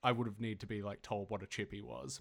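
ordinary delivery

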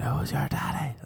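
high-pitched hammed-up coach shout; high mock shout. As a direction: taunt the class like a crazed trainer. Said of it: "He started staring at me saying".

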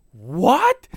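loud, high, stunned; shocked what. Retold or imitated. Wife hearing dad's crude threat, loudly shocked. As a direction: blurt it out in disbelief. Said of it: "Amy was like".

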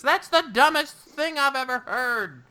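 high, scornful wizard voice; Danforth voice. Acted. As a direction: sneer it out high and dismissive.

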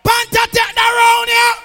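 high declaring shout; announcing shout. Real, not acted. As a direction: declare the winner to the hall. MC announcing the round winner.

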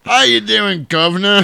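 loud, high mock cockney greeting; shouted over-the-top cockney accent; hammed-up cockney accent. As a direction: belt it out like a cartoon London cabbie in a cockney accent. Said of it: "That's totally different".

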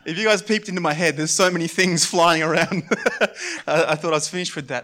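loud, fast, laughing; embarrassed laughing aside. Real, not acted. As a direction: laugh it off with the crowd. Laughing at own slip.

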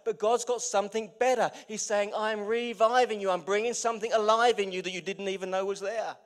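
loud, ringing preacher's build on "better"; full-force preaching. Acted. Preacher to his church about God reviving them, loud and fervent. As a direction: preach it out big to the whole room.

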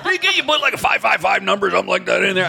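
high, fast, panicky protest; Rich whining. Retold or imitated. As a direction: fret like a nervous friend. Voicing Rich in retold argument.

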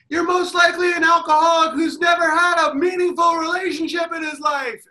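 high shouted heckle at the player; loud, high taunting heckler; heckling shout. Retold or imitated. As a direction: yell it across the stands. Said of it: "you're just like".

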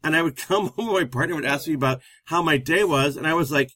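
ordinary talk

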